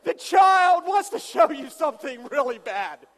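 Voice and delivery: loud, slow shouted point; slow shout, driving it home to the crowd; exasperated shout. Real, not acted.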